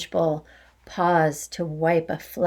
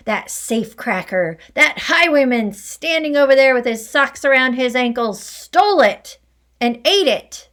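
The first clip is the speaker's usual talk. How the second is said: loud, higher angry rant; furious tirade; 3 semitones higher, 5 dB louder than usual. Acted. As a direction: rage on about the stolen cake. Headmistress's furious accusing tirade.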